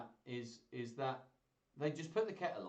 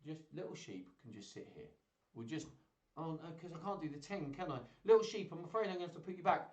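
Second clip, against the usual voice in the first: ordinary delivery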